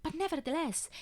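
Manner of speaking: ordinary delivery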